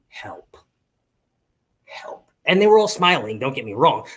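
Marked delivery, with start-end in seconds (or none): 0.1-2.3 breathy whispered help, much softer; whispered plea. Retold or imitated. Overwhelmed waiters silently pleading, whispered. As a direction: whisper it like a desperate secret. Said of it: "just was like".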